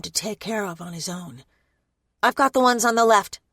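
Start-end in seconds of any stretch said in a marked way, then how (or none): 2.2-3.4 louder, calling across the fight. Acted. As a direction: call it out over gunfire. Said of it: "I call".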